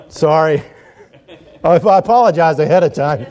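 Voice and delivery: chuckling through the words; can't keep a straight face apologizing; sheepish laughing apology. Real, not acted. Laughing at his own pun.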